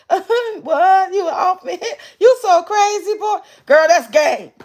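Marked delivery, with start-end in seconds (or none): 0.1-3.4 high, giggling mock of the caller; hammed-up flattered giggle through the words; mock giggly laugh. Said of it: "Did y'all hear the little laugh?".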